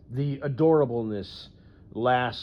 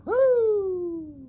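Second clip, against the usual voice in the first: high, loud whooping woo; a wolf-whistle of a woo; excited woo; 8 dB louder than usual. Real, not acted. Whooping at the dress in the video, excited.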